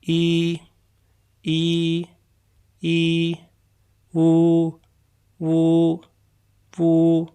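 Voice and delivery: "ई" held thrice, then "वू" with rounded lips; Chinese vowel drill. Shown as a demonstration. Teacher models "ई" then "वू" for practice. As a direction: model "ई" and "वू" slowly, one by one. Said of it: "आइए इसकी practice करते हैं".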